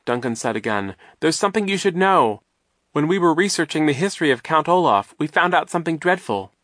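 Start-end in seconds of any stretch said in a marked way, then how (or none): 1.2-6.5 high, loud, anxious telling; raised, alarmed voice; urgent warning. A narrator's reading. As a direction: get the dreadful news out urgently. Duncan urgently warns Klaus.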